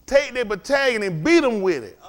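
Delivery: escalating preacher repeat. Shown as a demonstration. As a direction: drive the line home harder.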